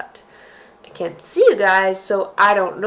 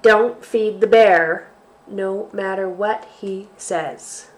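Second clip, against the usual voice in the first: slow, expressive picture-book reading; reading to children. Acted. Teacher reads a bear story aloud to kids. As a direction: read it out to little ones with relish.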